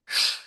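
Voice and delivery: "sh" as a long hushing hiss; sh sound. Shown as a demonstration. Teacher demonstrating the German sound, hushing sh. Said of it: "El sonido más repetido en alemán es".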